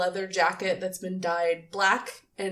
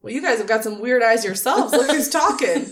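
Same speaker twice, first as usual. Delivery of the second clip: louder bravado, cracking into laughter; mock tough guy; 3 semitones higher, 7 dB louder than usual. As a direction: put on a cocky front and crack up halfway. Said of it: "puts up this tough guy face and is like".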